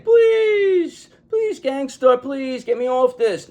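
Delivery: high, drawn-out mock pleading; hammed-up begging for rescue; begging plea. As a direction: beg in a high, stretched wail. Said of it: "begging, pleading, saying".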